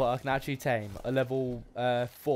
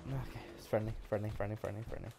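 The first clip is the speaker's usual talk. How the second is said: soothing murmur; 4 semitones lower, 11 dB quieter than usual. Real, not acted. Soothing a dinosaur.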